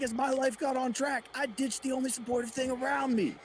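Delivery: high, loud mock Goggins; Goggins impression. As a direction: shout it earnestly. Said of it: "He's like".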